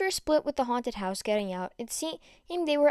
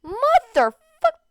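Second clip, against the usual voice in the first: high, loud exasperated swear; shrieking frustrated curse; frustrated outburst; 13 semitones higher, 10 dB louder, much slower than usual. Acted. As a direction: explode in disbelief. Izuku's outburst at news.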